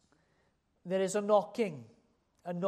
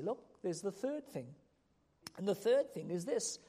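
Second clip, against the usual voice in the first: ordinary talk, nothing marked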